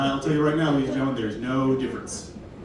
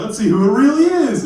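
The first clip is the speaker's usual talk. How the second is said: mock Scooby gang exclaim; 7 semitones higher, 7 dB louder than usual.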